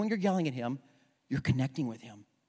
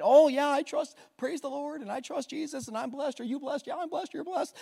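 mock churchy talk; 9 semitones higher than usual. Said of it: "all these churchy things".

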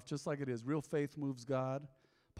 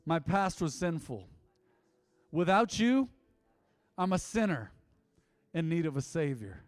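leading prayer; 3 semitones higher, 6 dB louder than usual. Acted. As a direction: lead the room in prayer, phrase by phrase. Leads the sinner's prayer.

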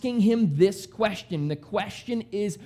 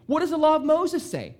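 higher, lighter questioning Jesus; Jesus asking; 7 semitones higher than usual. Retold or imitated. Preacher voicing Jesus quizzing the scholar, a bit higher. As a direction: toss the question back lightly. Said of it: "Jesus asked".

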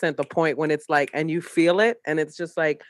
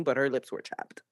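mock viewer; 3 semitones lower, 8 dB quieter than usual. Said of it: "just be like".

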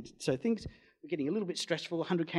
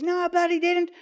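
high, loud mock protest, voiced much higher; mock indignant denial; 8 dB louder than usual. Hitchhiker denying the theft to the owner, high indignant protest. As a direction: play the accused man protesting. Hitchhiker denies stealing cigarettes.

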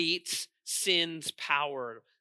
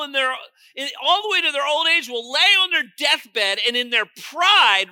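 raised, urgent pulpit voice; impassioned preaching; 8 semitones higher, 10 dB louder than usual. Real, not acted. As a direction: preach it hard, pitch lifted. Preaches at full force.

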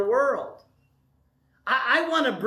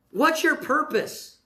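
usual speaking voice

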